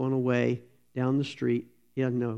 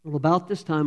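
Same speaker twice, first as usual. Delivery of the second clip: ordinary talk, nothing marked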